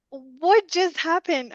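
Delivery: high, slow, wide-eyed what just happened; stunned disbelief. Retold or imitated. Left alone holding nothing after the mom runs off, baffled. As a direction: let it hang, baffled, drawn out.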